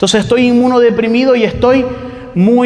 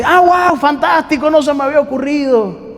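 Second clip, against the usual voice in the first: much higher, mock-delighted "guau, fantástico"; sarcastic reply; 7 semitones higher than usual. A depressed woman mocking useless advice, falsely thrilled and high. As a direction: gush with fake amazement to mock the advice.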